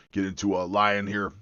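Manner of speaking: ordinary talk, nothing marked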